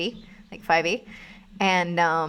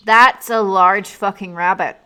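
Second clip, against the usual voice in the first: slow, loud stunned outburst; shocked yell; 13 dB louder, slower than usual. Real, not acted. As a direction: blurt it out in disbelief at the size. Shocked at the giant rabbit.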